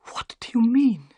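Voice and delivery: scared whisper; frightened whisper. A narrator's reading. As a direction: breathe the question in fear. Sholto shaking with fear.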